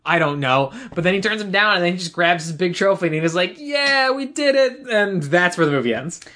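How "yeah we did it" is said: high, triumphant imitation; mock victory cheer. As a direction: imitate a trophy winner celebrating. Retells champion celebrating with trophy.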